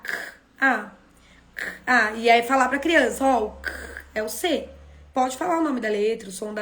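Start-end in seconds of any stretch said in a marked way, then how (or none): 0.0-2.1 K and a as separate sounds; sounding out phonemes. Shown as a demonstration. Teacher sounding out ca for children. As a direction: say each sound of ca apart. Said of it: "Como que é o ca".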